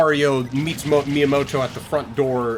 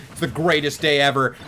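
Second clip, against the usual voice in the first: ordinary talk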